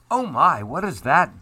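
a touch of a startled duck; quacking duck. A narrator's reading. Duck asks Goose about the thing found, lightly quacking. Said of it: "Duck quacked".

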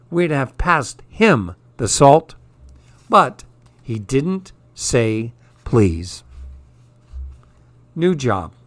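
ordinary delivery, nothing marked

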